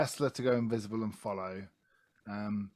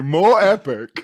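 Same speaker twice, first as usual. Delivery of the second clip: epic announcer boom; 5 semitones higher, 15 dB louder, much slower than usual. Shown as a demonstration. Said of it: "More history".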